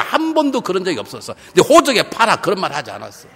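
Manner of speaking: loud, high, impassioned sermon; full-force preaching. Real, not acted. Preacher contrasts Jesus on the cross with us, full-force preaching. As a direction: drive it home to the whole congregation.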